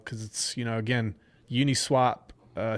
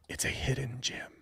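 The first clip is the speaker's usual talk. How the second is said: whisper; 8 dB quieter than usual. Acted. Hyping a coin, secretive aside.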